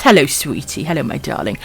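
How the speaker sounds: soft pet greeting. Real, not acted. Greeting her pet softly.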